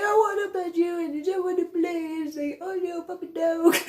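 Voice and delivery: high, whining dog's plea; whiny puppy voice. Retold or imitated. Voicing the dog, high whiny plea. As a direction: wail like a sad pup.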